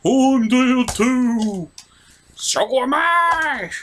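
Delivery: high, slow mock death shouts; hammed-up dying cry. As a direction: overact each dying rebel. Characters dying in parody.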